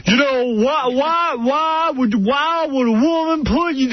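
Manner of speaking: high, slow, drawn-out folksy counselor; motivational advisor impression. Advisor telling husband to talk to wife, hammed-up. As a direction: lecture like a hammed-up TV advice giver. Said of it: "he's like that motivational speaker".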